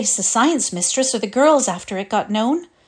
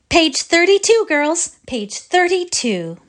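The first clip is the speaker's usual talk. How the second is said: put-on loud, high call to the girls; calling to the class; 9 semitones higher, 4 dB louder than usual. Teacher calls the choir to the page, loud and bright. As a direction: summon the whole class with it. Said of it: "rapped with her baton".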